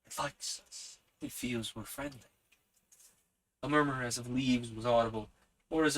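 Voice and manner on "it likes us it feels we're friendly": whisper. A narrator's reading. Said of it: "breathing very low".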